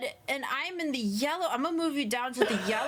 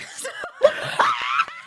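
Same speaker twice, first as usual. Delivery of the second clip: loud laugh; 14 semitones higher, 9 dB louder than usual. Real, not acted. Laughs at own joke.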